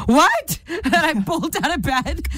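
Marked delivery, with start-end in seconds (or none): 0.1-0.6 sharp, high and loud what; waking in alarm at the news; startled. Retold or imitated. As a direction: jolt awake in alarm. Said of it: "I'm like".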